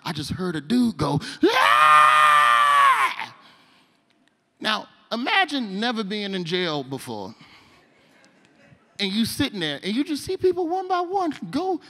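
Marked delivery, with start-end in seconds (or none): 1.4-3.4 long high-pitched full-throated scream; loud scream. Retold or imitated. Inmate screaming in the jail pod, long high scream. 9.9-11.8 high-pitched, strained build-up; mock dread. New inmate watching people vanish, high mock dread.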